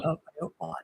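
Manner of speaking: a soft, half-hearted Yorkshire "hey up, lad"; echoing his friend, lightly putting on Yorkshire; mock Yorkshire. Quiet Yorkshire copy. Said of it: "the accent".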